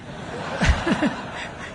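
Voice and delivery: breathy soft chuckle; a quiet laugh to himself. Real, not acted. Preacher chuckling at his own joke.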